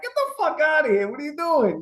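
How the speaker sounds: high mock indignation; mock outrage. Real, not acted. Uno joke to his co-host, high mock outrage. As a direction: call out a cheater, playing it up. Acting the Uno cheat bit.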